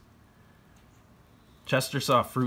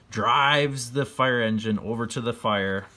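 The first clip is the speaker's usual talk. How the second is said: ordinary delivery, nothing marked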